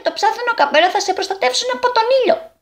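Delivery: high, quick story-character voice; small fisherman character. Acted. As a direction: speak high and fast as the little fisherman. (ψιλή, γρήγορη φωνή χαρακτήρα)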